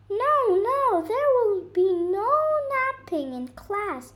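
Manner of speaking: declaring bird. Acted. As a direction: announce the class rule as the bird. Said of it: "declared the bird".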